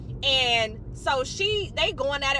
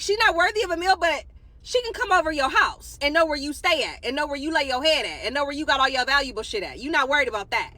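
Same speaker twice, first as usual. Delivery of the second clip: usual speaking voice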